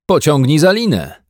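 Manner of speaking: lively boating command; shouting back. A narrator's reading. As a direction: shout the order back to the bear. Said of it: "odkrzyknął pan Brown".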